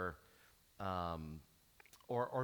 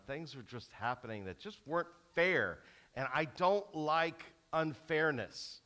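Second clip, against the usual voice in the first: ordinary delivery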